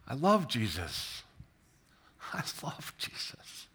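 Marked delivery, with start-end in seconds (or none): 2.3-3.7 whispered, much softer, all breath; hushed, private confession; heartfelt whisper. Real, not acted. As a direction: let it out as a moved whisper. Repeats it, moved, in a whisper.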